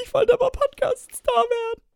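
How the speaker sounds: high, put-on whimper; mock whiny contestant voice; whiny hopeful. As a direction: play the crushed candidate. Candidate whines back.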